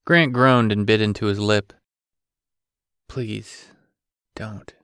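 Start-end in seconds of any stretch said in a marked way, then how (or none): 3.1-4.7 very soft, strained plea; faint, ragged. A narrator's reading. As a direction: barely get it out. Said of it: "It was faint and ragged".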